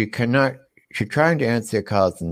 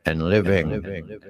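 creepy news voice; 5 semitones lower, 5 dB quieter than usual. Acted. Host delivers gross facts, creepy news voice. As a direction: read it eerily, sinking to a whisper on the sign-offs. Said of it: "turn on my creepy news voice".

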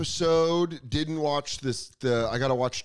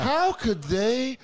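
higher, slow, rising indignation; mock outrage, pitched up; 8 semitones higher, much slower than usual. Host mocking viewers again, higher and slower shocked. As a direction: stretch it up high, scandalized. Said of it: "you're supposed to watch that stuff and be like".

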